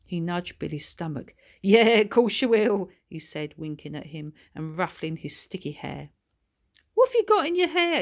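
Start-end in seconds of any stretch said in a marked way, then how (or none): 1.6-2.9 loud, higher mocking agreement; sarcastic teasing. Paul teasing Billy sarcastically. 6.9-8.0 fast, much higher, louder squeal; disgusted shriek. Acted. Paul disgusted by sticky hair.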